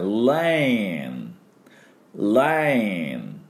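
レーン as English "lane", light L, long ei, held N; very slow, drawn-out "lane" for レーン; 綺麗な英語の発音. Shown as a demonstration. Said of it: "というと、綺麗な、". (レーン を英語の lane としてゆっくり伸ばして)

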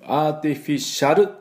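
アーティフィシャル with a full シャル ending; アーティフィシャル with every vowel pronounced; katakana reading. Shown as a demonstration. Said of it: "と言わないでください".

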